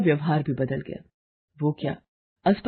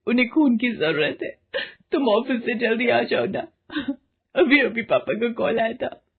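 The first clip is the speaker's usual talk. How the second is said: put-on higher, urgent young woman's voice, near tears; panicked wife on the phone; 6 semitones higher than usual. Wife tells husband of brother's accident, tearful and urgent. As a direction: rush the bad news out, breaking up.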